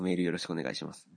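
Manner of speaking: normal talking voice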